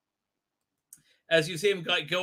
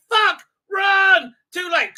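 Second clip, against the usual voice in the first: much higher, louder shriek to run; hammed-up fleeing Thal; panicked shout; 11 semitones higher, 8 dB louder, slower than usual. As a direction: scream it while bolting. Thals panic and flee.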